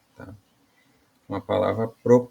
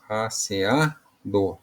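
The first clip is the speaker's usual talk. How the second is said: "asseado" slowly, leaning on the A before DO; stress on the second A; much slower than usual. Shown as a demonstration. Teacher showing the strongest syllable, "asseado" slowly with stressed A before DO. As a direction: stretch the A in "asseado", pause before the final DO. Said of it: "é a sílaba mais forte".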